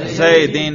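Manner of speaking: "زیدین" ending clearly in -in; jarr ending. Shown as a demonstration. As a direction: say "زیدین" with a final "in" sound. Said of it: "جر پہ کریں". ("زیدین" جر کے ساتھ، آخر میں "اِن")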